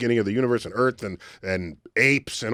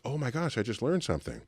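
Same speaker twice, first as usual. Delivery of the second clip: quiet realization; 9 dB quieter than usual. Retold or imitated. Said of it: "And then I realized".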